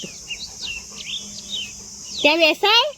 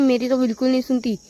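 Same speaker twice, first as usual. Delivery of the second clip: ordinary talk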